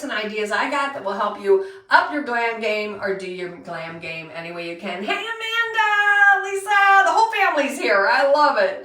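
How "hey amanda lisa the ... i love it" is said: high, loud, happy welcome; delighted greeting. Real, not acted. Host thrilled her family joined, calling high and loud. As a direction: call out to the whole family.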